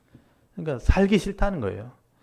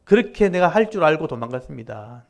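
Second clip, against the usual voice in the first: louder mock praise; sarcastic retort; 5 semitones higher, 5 dB louder than usual. Jonah throwing God's mercy back sarcastically. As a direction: praise said as a sneer. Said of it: "비꼬면서 얘기하는 거예요".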